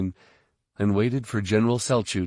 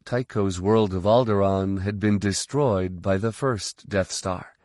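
ordinary delivery

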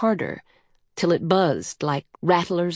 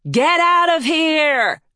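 high, drawn-out yell; 9 semitones higher, 4 dB louder, slower than usual. A narrator's reading. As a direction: yell a warning while fleeing.

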